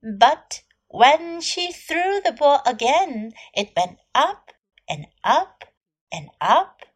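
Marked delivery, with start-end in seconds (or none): none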